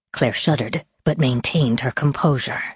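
usual speaking voice

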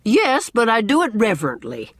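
high, loud objection; insistent, mildly offended protest. A narrator's reading. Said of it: "protested Missus Lynde".

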